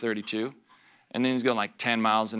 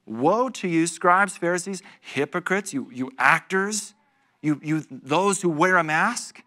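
louder, declaiming the woe; scripture proclamation; 4 semitones higher, 4 dB louder than usual. Acted. Pastor reads Jesus' woe to the Pharisees, declaiming. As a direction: proclaim it like a solemn warning.

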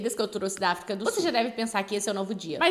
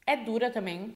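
chewing candy while talking; biting gummy snake; mouth full of gummy. Real, not acted.

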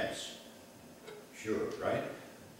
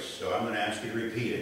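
ordinary talk

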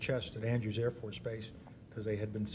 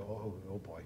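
put-on much softer, lower "oh boy" of dread; 5 semitones lower, 11 dB quieter than usual. General fearing the pilot will blurt something, soft dread. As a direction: brace for what's coming, under your breath. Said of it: "and I go".

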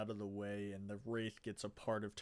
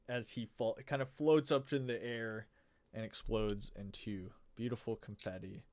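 usual speaking voice

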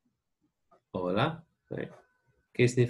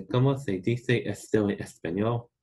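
¿Cómo se dice esto en español? with "se" and "dice" lisped as th; Castilian th in "se dice", Spain style; Spain Spanish accent. Shown as a demonstration. Teacher demos the Spain option for students, Castilian Spanish accent. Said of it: "if you wanna do the Spain accent".

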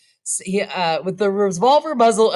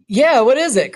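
put-on loud, high old man's cry; startled cry; 5 semitones higher, 7 dB louder than usual. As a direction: call out in alarm. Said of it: "cried the old man".

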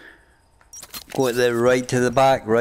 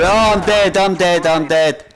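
high, loud cry of being killed; real gaming shout; 5 semitones higher, 5 dB louder than usual. Real, not acted. Player telling teammates he is down, shouted. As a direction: shout out your own death to the team. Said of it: "Sorry if I shout".

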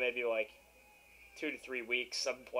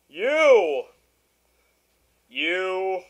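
high, much louder yelling at the screen; retold screaming; 8 semitones higher, 15 dB louder than usual. Gamer screaming at the player who killed him. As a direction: yell it like the enemy just robbed you. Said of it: "I was screaming".

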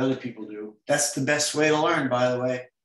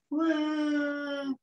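much higher mock crying wail; cartoon tantrum wail. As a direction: wail like a toddler mid tantrum. Voicing crying drawn child.

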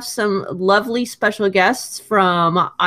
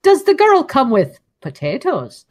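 mock Irish accent, a bit higher; a playful put-on Irish brogue; jokey Irish accent asking about the girl; 4 semitones higher than usual. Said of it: "that was a very good Irish accent".

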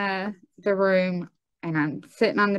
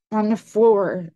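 ordinary delivery, nothing marked

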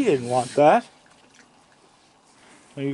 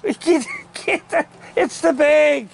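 high laughing pet talk, slow; 11 semitones higher, slower than usual. Real, not acted. Laughing at the animal fussing over the empty bag, high and amused. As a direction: chuckle it out in a much higher voice.

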